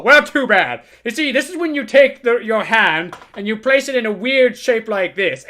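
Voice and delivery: a hint of a rattled old man ranting; crude old lawyer. Old lawyer ranting about the girl, mildly worked up. Lawyer boasts, reacts loudly.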